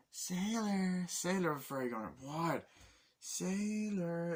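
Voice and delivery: high, floating calling voice; dreamy fairy calling Sailor; ethereal fairy call. Retold or imitated. Fairy vision calling his name.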